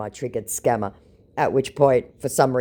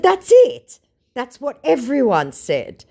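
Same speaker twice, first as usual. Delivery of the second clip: high, loud sarcastic jab; 12 semitones higher, 6 dB louder, slower than usual. Retold or imitated. Mocking the scammer, high and loud, sarcastic. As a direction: scoff at his claim.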